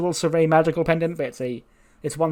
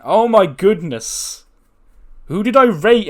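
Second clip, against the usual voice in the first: higher, louder, drawn out in disbelief; amazed; 6 semitones higher, 6 dB louder, slower than usual. Real, not acted. Gasping at the segment rating for viewers, stunned. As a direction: stretch it out, stunned by the score.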